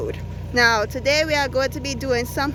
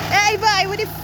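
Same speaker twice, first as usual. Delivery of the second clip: loud call; 9 semitones higher, 3 dB louder, faster than usual. Real, not acted. Calling out, cut off.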